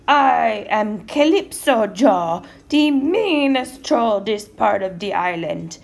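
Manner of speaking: big, slow, booming troll roar; troll's roar. A narrator's reading. Mean troll warning anyone crossing his bridge, roaring slowly. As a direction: roar it out loud, stretching the words. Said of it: "roared the troll".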